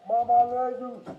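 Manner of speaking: real loud desperate cry to his mother; real distress, loud plea; anguished cry. Real, not acted. Restrained man crying out.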